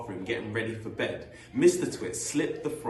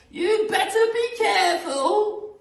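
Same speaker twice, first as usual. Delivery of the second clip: slow, very high, loud warning; Missus Twit; 9 dB louder than usual. Acted. Missus Twit warning her husband, slow screechy falsetto.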